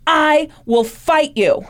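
slow, rising defiant dare; defiant challenge. Retold or imitated. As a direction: challenge the demon slowly. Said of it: "Arnie Cheyenne Johnson was like".

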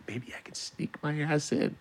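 very soft, sneaky murmur; hushed scheming to yourself; sneaky whisper-thought. Retold or imitated. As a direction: plot it under your breath.

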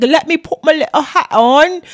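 hammed-up academic voice; professor voice. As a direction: play a prissy little professor putting on her thinking cap. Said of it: "kinda like the professor".

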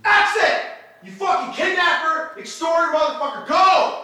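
real screaming rage at an intruder; furious yelling. Real, not acted. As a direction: yell him out of the house. Roommate screaming at intruder on stream.

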